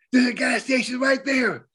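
high-pitched excited heads-up; imitating an urgent backseat-driver alert; retold sudden call-out. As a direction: pipe up high like a passenger spotting it. Passenger calls out to the driver.

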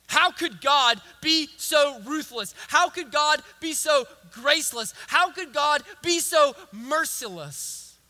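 loud, high, slow outrage at God; angry protest. Acted. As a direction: hammer out each accusing question. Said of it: "David's reaction is anger with God".

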